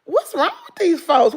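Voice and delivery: ordinary delivery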